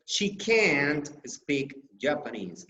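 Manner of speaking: "can't" long and stressed. Shown as a demonstration. Teacher contrasts the negative with can, stress on can't. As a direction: make "can't" the main beat. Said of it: "But in the negative, we say".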